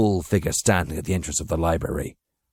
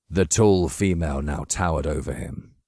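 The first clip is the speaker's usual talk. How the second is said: ordinary delivery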